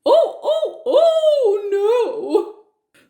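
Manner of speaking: high, loud mock-panicked yelps; wolf panicking. Wolf cries out when the woodcutter threatens him, high and panicked. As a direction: yelp in alarm.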